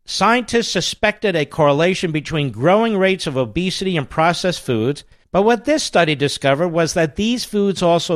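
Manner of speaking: upbeat host-read ad. Acted. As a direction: sell it like a radio ad. Host reads sponsor ad.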